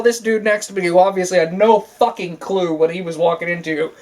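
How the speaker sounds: ordinary talk, nothing marked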